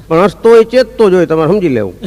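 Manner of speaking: ordinary talk, nothing marked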